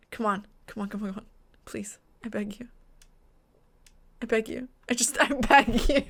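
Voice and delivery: low, hushed begging under the breath; quiet pleading. Real, not acted. Player begging a dying controller, hushed desperate pleading. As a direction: plead softly, almost whispering.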